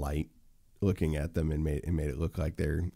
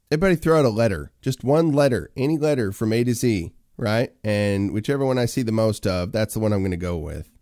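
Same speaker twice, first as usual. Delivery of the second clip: louder, higher, fast call to the viewers; rally the chat to answer; 4 semitones higher, 6 dB louder than usual. Real, not acted. Streamer calling on chat to send letters, loud and raised. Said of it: "start throwing letters into the chat".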